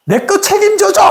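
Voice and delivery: acted high-pitched angry plea; angry demand. Angry person demanding the other take responsibility, high-pitched outburst. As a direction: demand it in a mock fit of temper. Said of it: "왜 화를 확 내냐면".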